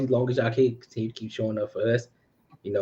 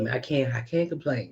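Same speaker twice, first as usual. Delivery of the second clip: ordinary delivery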